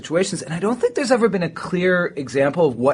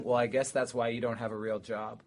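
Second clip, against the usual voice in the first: much softer, under the breath; muttered thought; 4 semitones lower, 11 dB quieter than usual. Retold or imitated. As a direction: mutter it to yourself snidely. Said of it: "I remember thinking at the time".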